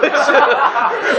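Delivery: high, giggling burst of laughter. Real, not acted. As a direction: laugh at how absurd it was. Laughing at the memory.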